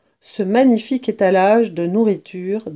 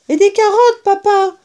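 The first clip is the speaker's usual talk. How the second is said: put-on much higher, child cub voice; little fox cub; 11 semitones higher, 5 dB louder than usual. A narrator's reading. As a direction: pipe up like the smallest cub. Smallest fox cub speaking.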